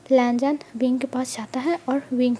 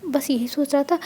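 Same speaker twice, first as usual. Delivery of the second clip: ordinary delivery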